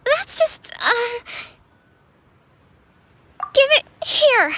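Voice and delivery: high, slow, stammering, then a loud grab; flustered embarrassment. Acted.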